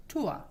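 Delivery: "tour" said as chu-a; English "ua" diphthong. Shown as a demonstration. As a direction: say "tour" with u gliding into schwa, no R. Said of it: "para el diptongo U A".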